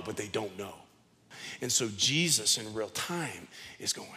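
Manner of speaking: low hushed sermon voice; soft, intimate. Acted. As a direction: draw them in close, almost murmuring. Softly voicing Jesus' understanding.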